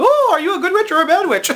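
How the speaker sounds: very high, loud, fast ditzy squeal; airhead Glinda. Retold or imitated. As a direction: play her dizzy from too little oxygen in the bubble. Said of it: "doesn't have enough oxygen".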